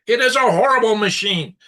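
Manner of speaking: loud, high exasperated outburst; real anger boiling over; frustrated rant. Real, not acted. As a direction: vent at the broken machine. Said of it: "pardon me for my rant".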